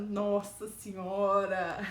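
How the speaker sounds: slow, laughing groan of dismay; mock-horrified thought. Her thought after scolding the boss, laughing. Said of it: "e pensando".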